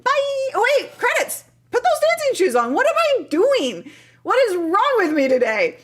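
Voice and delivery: loud, high mock panic; flustered self-scolding. Real, not acted. Streamer who forgot the credits, comically flustered. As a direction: cry out high as if just remembering.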